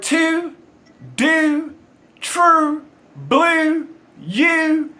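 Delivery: Baltimore vowels stretched high and slow on each word; Baltimore accent. Shown as a demonstration. Chef showing his Baltimore accent to the host. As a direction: show off the Baltimore oo sound, word by word. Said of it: "Talk about you and this accent of yours".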